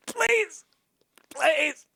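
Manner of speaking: high, pleading cry; desperate pleading. Real, not acted. Streamer watching his climber slip, desperate high pleading. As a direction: beg the character to hold on. Begging during a fall.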